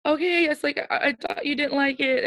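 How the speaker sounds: high-pitched imitation of crying; tearful retort. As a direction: squeak it out as if weepy. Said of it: "And I started crying".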